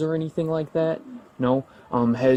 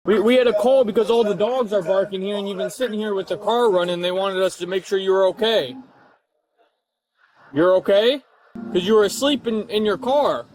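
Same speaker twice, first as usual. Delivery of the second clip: raised, higher-pitched explaining voice; yelling; 7 semitones higher than usual. Real, not acted. Officer explaining the call to a just-woken driver, raised voice. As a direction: speak up as if to someone barely awake. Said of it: "Why, why are you yelling?".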